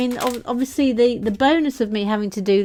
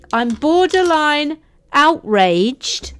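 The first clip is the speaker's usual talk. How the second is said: slow, high, drawn-out indignation; mock outrage; 7 semitones higher, 3 dB louder, much slower than usual. Host accused of an Australian accent, slow mock outrage. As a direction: stretch out "borderline outraged" with hammed-up offence.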